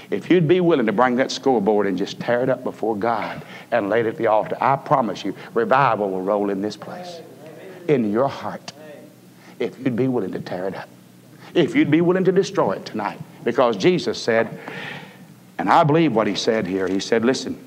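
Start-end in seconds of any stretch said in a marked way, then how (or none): none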